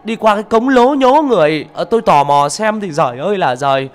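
high, loud panicked shouting; calling out frantically. Acted. Neighbour woman shouting to the wife that her husband is in trouble, high panicked yell. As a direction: yell the alarm from the gate. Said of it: "vừa gọi, vừa la ý ới".

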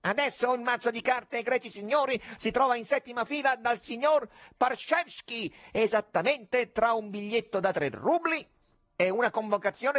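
mocking ringmaster voice; showman conjurer. A narrator's reading. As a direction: present it like a sly stage magician.

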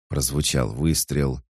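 plain reading voice

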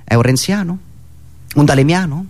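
ordinary delivery

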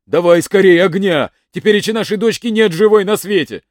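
loud, urgent order; shouting at his wife. Acted. Old man shouts at his wife to bring fire, loud and urgent. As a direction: bark for light in panic. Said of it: "и закричал на старуху".

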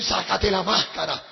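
slow, loud preacher thundering; prophetic rebuke. Retold or imitated. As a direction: denounce the hypocrite slowly and loudly. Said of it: "comenzó a hablar en lengua".

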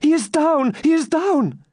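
very high, horrified cry; shrieked exclamation. A narrator's reading. Maiden sees champion fall, shrieking in horror. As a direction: cry out in acted alarm, pitched right up. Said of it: "uttered a loud shriek and exclaimed".

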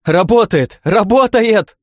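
high, loud cries of triumph, building; joyful shouting. A narrator's reading. Iren whirls her friend around, joyful shouting. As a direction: shout it out with delight. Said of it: "радостно кричала Ирен".

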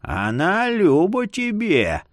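put-on slow, creaking old man; creaky old man voice. A narrator's reading. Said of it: "скрипел старик".

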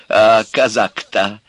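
громко и протяжно, с лестью; восхищаться напоказ, растягивая слова; льстивое восклицание. A narrator's reading. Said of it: "льстиво воскликнула она". (льстиво, подлизываясь)

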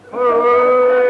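a high, loud yelp of alarm; sudden high yelp when the flame burns him; startled yelp. Acted. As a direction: jump back as the flame flares up. Lighter flame singes him.

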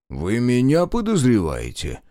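slow, indignant question rising high; affronted, drawn-out disbelief; offended. A narrator's reading. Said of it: "оскорбился Марков".

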